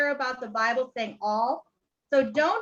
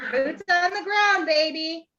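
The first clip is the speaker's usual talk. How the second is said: excited; 5 semitones higher, 4 dB louder than usual. Real, not acted. Echoing Heather excitedly.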